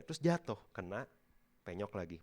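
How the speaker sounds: usual speaking voice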